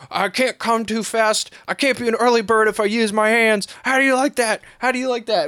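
high, strained mock yell; retold yelling. Father to the woman in the retold film, high mock yelling. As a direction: imitate a man yelling, pitched well up. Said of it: "he's yelling at her".

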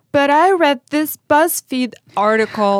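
put-on high, loud whiny old person. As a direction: whine it like a cranky old person. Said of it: "Your old man voice".

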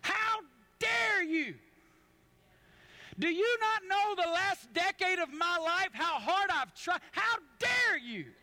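"How dare you?" thrown back in outraged disbelief; furious retort. Retold or imitated. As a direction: fire back in hurt outrage. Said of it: "I was furious".

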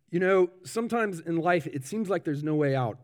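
attempted New Zealand funeral minister; New Zealand accent. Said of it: "I am gonna attempt, uh, the accent".

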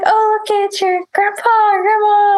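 high, playful retold greeting; high-pitched mock cheer; cheery baby showing-off. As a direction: imitate an excited parent showing the baby. Retells dad showing baby to grandparents.

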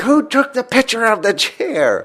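high, drawn-out mock outrage; pissed-off mother. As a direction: imitate an exasperated mom demanding answers. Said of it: "My mother would get so pissed".

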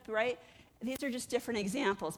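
ordinary delivery

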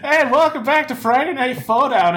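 big, high, loud show-host welcome; announcer voice. Acted. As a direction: boom it out like a ringside announcer. Podcast show opening announcement.

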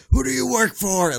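high, loud mock interrogation; mock interrogator. Teen retelling the principal's questioning, loud mock interrogator. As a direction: bark it like a cop grilling a suspect. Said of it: "coming at me like an interrogator".

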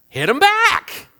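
high, loud mock retort; playfully hammed-up loud comeback; retaliatory outburst. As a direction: burst out with the gut reaction. Acts out first angry response.

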